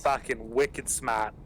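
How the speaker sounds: slow put-on Boston accent. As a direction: imitate a Massachusetts buddy's Boston accent. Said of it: "the only thing that I say in that accent".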